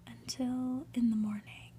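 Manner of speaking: plain reading voice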